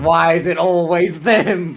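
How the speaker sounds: high, loud mock complaint; Banks. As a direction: whine it loudly, dragging the words out. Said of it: "Banks is like".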